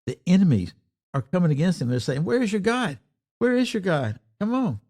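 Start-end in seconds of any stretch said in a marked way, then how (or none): 2.2-4.8 high-pitched mimicked jeer; mock challenge, pitched well up; taunting. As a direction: goad them like a scornful adversary. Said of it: "the adversaries, the oppressors, the enemies".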